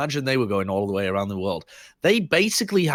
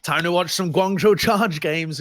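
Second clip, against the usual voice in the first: slow and higher, like an eager fan; excited fan voice; 6 semitones higher, 3 dB louder than usual. Retold or imitated. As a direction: play a keen fan about to watch a match. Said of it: "You're like".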